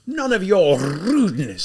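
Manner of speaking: slow, loud, high roar; livid. Acted. Livid lieutenant roaring at the student, loud and slow. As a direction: bellow it, drawing each word out. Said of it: "so livid".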